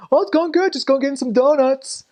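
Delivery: very high, chummy reply; hammed-up easygoing cop banter; imitated friendly cops. Cops answering a missionary friend, high cheery imitation.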